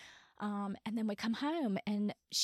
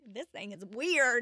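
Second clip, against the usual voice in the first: louder, higher, slower, baffled at the watch; 3 semitones higher, 7 dB louder, slower than usual. Retold or imitated. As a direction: blurt it out, confused and amused. Acted baffled quote, Apple Watch.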